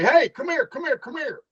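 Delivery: high-pitched, quick beckoning call. Retold or imitated. Officer waves a man out of traffic, calling out. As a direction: call him over, voice lifted high.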